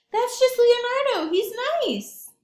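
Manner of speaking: high, loud reassuring voice; calm the frightened girl; cheerful monster voice. Acted. Frankenthaler reassures Carrie, high cheerful reassurance. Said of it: "said Frankenthaler to Carrie".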